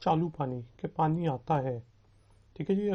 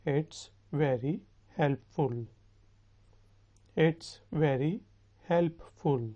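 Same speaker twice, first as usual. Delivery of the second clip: slow, with a break before helpful; slow model sentence; much slower than usual. Shown as a demonstration. Teacher translating for students to repeat, slow and measured. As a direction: space it out for learners to copy.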